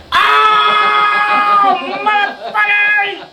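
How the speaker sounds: high, loud yelp of pain; pained yell. Retold or imitated. Buddy yelling as the dart sinks in, high yelp. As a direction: cry out high as the dart stings.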